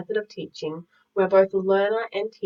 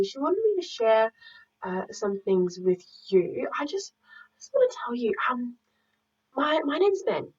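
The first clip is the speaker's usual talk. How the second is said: put-on boy from story; 3 semitones higher than usual. Boy asking students for help with a lost thing, boyish voice. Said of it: "enroll as the boy from the story".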